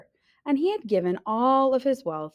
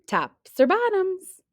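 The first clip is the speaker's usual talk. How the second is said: slow, much higher, drawn out; teasing choice; 11 semitones higher, 3 dB louder, slower than usual. Acted. Hare tempting bear with the choice, teasing. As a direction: dangle the choice.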